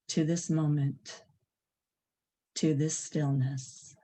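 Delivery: unhurried, spacious meditation leading; guided meditation. Acted. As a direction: lead them gently into stillness, phrase by phrase.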